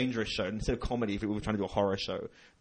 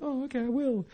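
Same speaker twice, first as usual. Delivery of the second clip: mock meek reply. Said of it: "And he went".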